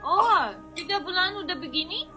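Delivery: normal talking voice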